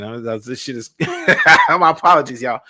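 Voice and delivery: breaking into a laugh mid-apology. Real, not acted. Laughs at own stumbling reading.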